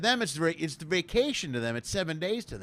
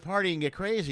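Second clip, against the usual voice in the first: ordinary delivery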